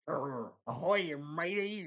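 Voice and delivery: a hammed-up pirate accent. Reviewer shows the pop-culture pirate, in a mock pirate accent. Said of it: "the pirate accent".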